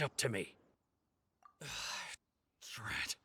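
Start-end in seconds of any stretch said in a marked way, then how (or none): 1.6-2.1 long deflated sigh; heavy sigh. Acted. Beaten thief giving up, heavy sigh. 2.7-3.2 breathless resigned whisper; whispered defeat. Acted. Thief admitting defeat to the detective, whispered curse.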